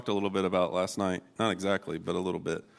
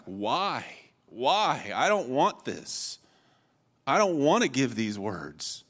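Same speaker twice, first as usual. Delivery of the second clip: slow, drawn-out imitated lament; whiny, swooping protest; 3 semitones higher, slower than usual. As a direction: plead reluctantly with the Lord. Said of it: "Jeremiah is lamenting to the Lord".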